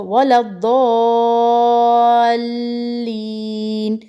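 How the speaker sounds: slowly drawn वलद्दोलीन from the Fatiha; six-count madd. Shown as a demonstration. Teacher reciting the daily prayer word for students, long madd. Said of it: "ये सूरह फातिहा में हर नमाज में".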